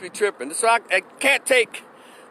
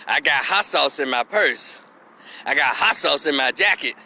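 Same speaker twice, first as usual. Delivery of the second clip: mock pandering politician; 6 semitones lower than usual. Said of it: "not once did they ever said".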